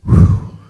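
loud breathy exhale of relief; relieved whew. Acted. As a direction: blow out air after a narrow escape. Acts out escaping the dog.

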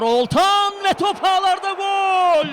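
slow, high, loud goal scream; screaming football commentator. Real, not acted. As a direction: stretch the goal call at full voice. Goal scored in live match.

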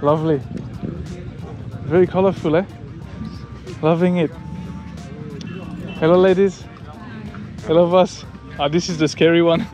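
ordinary talk

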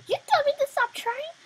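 very high, very loud mock outrage; loud squeal. Real, not acted. Protesting to the other player, high loud squeal.

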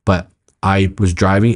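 normal talking voice

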